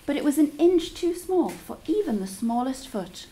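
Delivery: ordinary delivery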